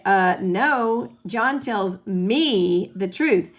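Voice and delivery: slow, insistent stress on "me" in "John tells me the truth"; voice emphasis on me. Shown as a demonstration. To someone calling John a liar, stressing "me" slowly. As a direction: lean hard on "me", said slowly. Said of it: "we do it with our voice emphasis".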